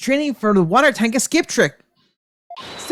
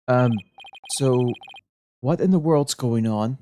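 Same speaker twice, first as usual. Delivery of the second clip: much lower, mumbling detective voice; low-voiced Larry; 6 semitones lower than usual. Larry explaining the police commotion to Nick, voiced low. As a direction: drop deep as the bumbling detective.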